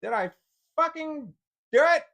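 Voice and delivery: real frustration boiling over; frustrated yell. Real, not acted. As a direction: yell it at the stubborn screen. Frustrated at failing zoom.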